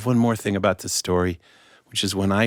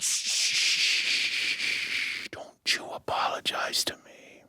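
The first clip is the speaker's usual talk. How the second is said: soft, whispered shushing; gentle hushing; 7 dB quieter, much slower than usual. Acted. King hushes the apologizing beggar, whispering. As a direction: hush him down to a whisper.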